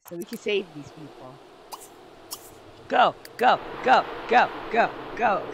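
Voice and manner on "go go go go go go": short, loud, high bursts of "go"; urging shouts. Real, not acted. Player urging game characters into a burning house, urgent shouting. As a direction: urge them on in punchy shouts.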